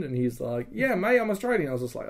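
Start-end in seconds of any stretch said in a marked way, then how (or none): 0.7-1.7 higher-pitched imitation of an Australian; Australian accent. Guy by the fire answers the gunman, in an Australian accent. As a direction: put on a light Aussie accent. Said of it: "speaking with an Australian accent".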